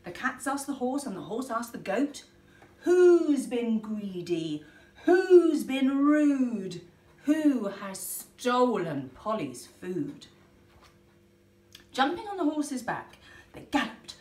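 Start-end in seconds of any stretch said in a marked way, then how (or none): 2.8-10.3 loud, slow accusing questions; slow loud demand. Acted. Animals asking who was greedy, loud and slow. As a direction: boom each question out.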